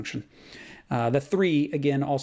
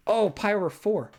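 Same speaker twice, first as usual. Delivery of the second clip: ordinary delivery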